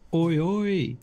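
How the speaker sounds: high calling shout into the distance. Acted. Halfling thief calling down the corridor, high shout. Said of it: "And I shout".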